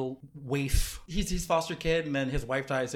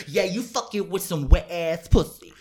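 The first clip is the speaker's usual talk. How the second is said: slow, louder sassy line, playing it bold; sassy quote; 6 dB louder than usual. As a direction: brazen and proud, a bit louder and slower. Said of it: "I'm like".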